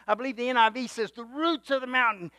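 usual speaking voice

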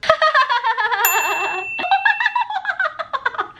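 high cackling laugh; showcase laugh. Shown as a demonstration. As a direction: let a big laugh roll. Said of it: "and my laugh".

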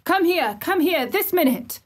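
high, urgent repeated command; frantic high-pitched ordering; shouted summons. A narrator's reading. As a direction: demand he come at once. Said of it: "he shouted to Dickon".

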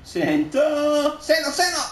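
urgent shouts for a cross; shouted call. Real, not acted. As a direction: bellow for the pass. Yelling to teammate in match.

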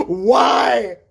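loud, exasperated mom yelling why; fed-up mom bellowing at her kid; mother's exasperated shout. Retold or imitated. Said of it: "the one word I heard from my mother".

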